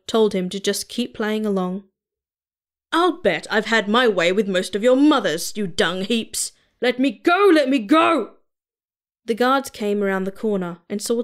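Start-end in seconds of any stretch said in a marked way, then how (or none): 2.9-8.4 screaming. A narrator's reading. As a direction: scream like you've lost it. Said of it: "screaming at the top of his lungs".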